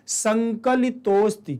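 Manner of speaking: संकलितोस्ति drawn out very slowly as one word, no अ; slow teaching demo of संकलितोस्ति; merged correct reading. Shown as a demonstration. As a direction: stretch संकलितोस्ति slowly, ओ gliding into स्ति. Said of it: "क्या पढ़ेंगे?".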